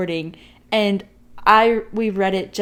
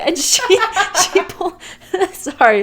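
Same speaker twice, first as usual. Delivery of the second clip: laughing while telling; 6 semitones higher, 8 dB louder than usual. Real, not acted. Laughing through the story.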